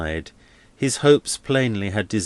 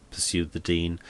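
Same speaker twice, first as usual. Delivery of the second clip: ordinary delivery, nothing marked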